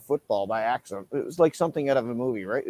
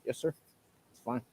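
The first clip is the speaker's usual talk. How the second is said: much softer, submissive yes sir; meek reply; 12 dB quieter than usual. Retold or imitated. Man answering the police officer meekly. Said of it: "I was like".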